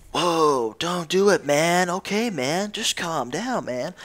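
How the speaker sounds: a hint of a calm stoner; lazy unbothered mock voice; mellow dude. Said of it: "The guy's like".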